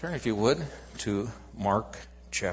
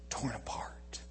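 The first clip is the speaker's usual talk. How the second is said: hushed whisper for effect; 8 dB quieter than usual. Acted. Preacher whispering the torn curtain to listeners. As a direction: breathe it out softly.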